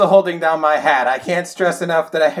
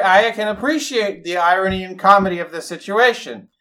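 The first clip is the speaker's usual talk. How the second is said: ordinary delivery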